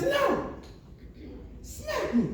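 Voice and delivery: high woman's voice, insisting; high woman voice correcting him; insistent woman. Retold or imitated. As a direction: demand it high-pitched.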